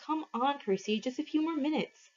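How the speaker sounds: lost in the game, whining softly. A narrator's reading. Annabeth dazed, pleading to stay.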